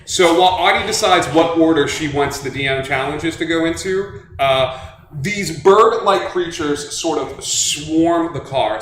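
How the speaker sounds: ordinary talk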